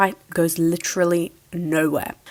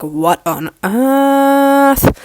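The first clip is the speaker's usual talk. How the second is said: very slow, louder, higher drawn-out cry; stunned shout; 6 semitones higher, 8 dB louder, much slower than usual. Retold or imitated. Everyone reacting to Gabe, in a long loud baffled cry. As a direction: stretch it out in loud disbelief.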